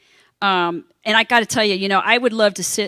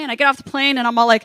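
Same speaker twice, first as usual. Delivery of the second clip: ordinary talk, nothing marked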